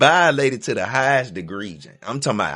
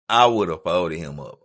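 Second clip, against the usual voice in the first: ordinary talk, nothing marked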